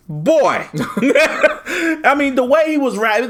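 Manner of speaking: high laughing speech. Real, not acted. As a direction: talk through laughter. Laughing while talking.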